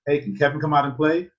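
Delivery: high, loud imitated child at the door; playful mock kid voice; kid asking. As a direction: pitch it up like a kid calling for a friend. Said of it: "I would go to my friend".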